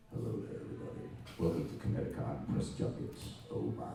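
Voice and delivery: breathy, raspy sexy voice. Pretend. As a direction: play up the raspy, sexy microphone voice. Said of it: "a voice like this, you sound, like, extra sexy".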